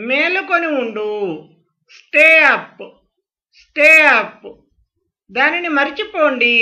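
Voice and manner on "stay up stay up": loud model phrase. Shown as a demonstration. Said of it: "teacher repeats phrase loudly".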